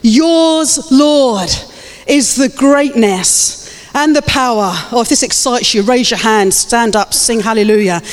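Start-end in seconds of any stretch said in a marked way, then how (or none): 0.0-4.7 slow, high, ringing proclamation; exultant, stretched-out praise; scripture declaration. Real, not acted. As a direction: declare it to God with drawn-out words. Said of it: "Come on, this is a declaration".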